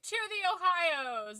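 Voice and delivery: mock toast. Retelling Brits toasting an American, mock celebratory cheer. Acted toast in retold story.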